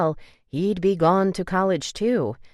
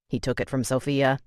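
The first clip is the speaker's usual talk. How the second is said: ordinary delivery, nothing marked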